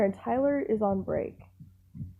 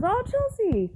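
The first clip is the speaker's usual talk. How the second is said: much higher, sing-song hello; warm greeting; 14 semitones higher, slower than usual. Retold or imitated. Boss happily greeting the scared young worker. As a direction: greet her like a fond uncle. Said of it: "He goes".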